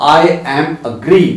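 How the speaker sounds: very slow, each word drawn out; slow wrong example. Shown as a demonstration. Teacher slowly spelling out the wrong form for students. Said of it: "you never say".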